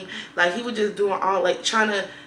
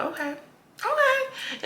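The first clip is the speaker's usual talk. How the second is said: mock high okay; 3 dB quieter, much slower than usual. Reacting during sex.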